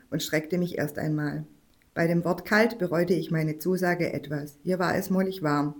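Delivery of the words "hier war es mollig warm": usual speaking voice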